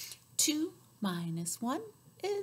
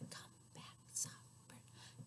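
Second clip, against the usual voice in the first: hushed whisper; 21 dB quieter, slower than usual. Shown as a demonstration. Finger rhyme for a child, whispering the soft bird.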